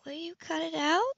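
lightly voiced woman; female character voice. Woman's line in romance.

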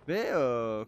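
normal talking voice

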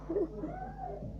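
short high-pitched giggle, quiet; high squeaky laugh. Real, not acted. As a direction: let out a squeaky little laugh. Laughs at his defeat. (tiz kısa bir kıkırdama)

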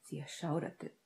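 usual speaking voice

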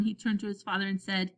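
plain reading voice

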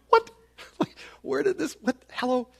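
high-pitched, comically baffled reader; mock bafflement. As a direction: squeak out confused questions, hammed-up. Mimics baffled readers of the verses.

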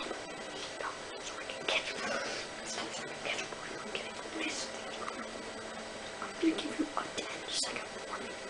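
whispered, very soft threat; barely audible playful warning; hushed warning. Pretend. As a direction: whisper a warning under your breath. Hushed warning while chasing in game.

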